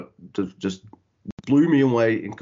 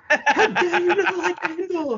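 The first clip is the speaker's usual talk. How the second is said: much higher, slow, laughing imitation of an offended fan; mock outraged friend; 3 dB louder, slower than usual. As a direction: parody his indignation through laughter. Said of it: "you're like".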